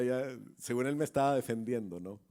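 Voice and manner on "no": ordinary delivery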